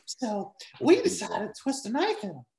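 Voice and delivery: high-pitched politician impression; hammed-up, high mock-politician voice; Warren. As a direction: pitch it way up into a put-on voice. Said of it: "can you do a Warren?".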